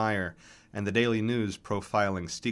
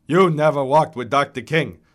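put-on loud, dismissive old man's contradiction; old baritone, loud and scornful; hoarse old man; 4 semitones higher, 10 dB louder than usual. A narrator's reading. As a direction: flatly deny it as a grumpy old rival. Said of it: "hoarse, tattered remnants of an operatic baritone".

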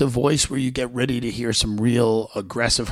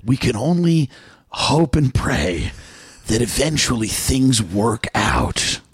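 movie trailer voice; slower than usual. Pretend. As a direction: announce it like a blockbuster trailer. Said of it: "the movie trailer voice".

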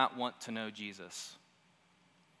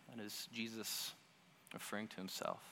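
usual speaking voice

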